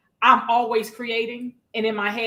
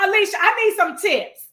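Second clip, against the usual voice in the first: retold plea; 5 semitones higher, 5 dB louder than usual. Retells own plea for help.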